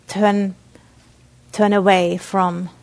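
ordinary talk

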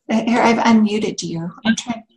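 ordinary delivery

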